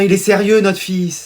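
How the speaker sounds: normal talking voice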